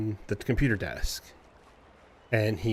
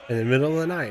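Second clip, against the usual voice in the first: usual speaking voice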